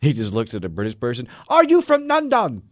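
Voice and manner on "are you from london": high, loud imitation of an American; high mock Paul Rudd. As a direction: squeak it out in a high goofy voice. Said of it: "her accent reminded me of Paul Rudd".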